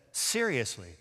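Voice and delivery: low, soft, incredulous sneer. Acted. Leah turning on Rachel over the mandrakes, sneering. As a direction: drop it low with quiet scorn. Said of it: "sneers and says".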